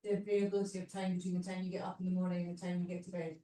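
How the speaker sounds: ordinary delivery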